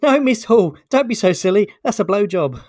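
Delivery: high, loud correcting pupil; cheeky schoolboy. Acted. Cheeky pupil correcting teacher in joke.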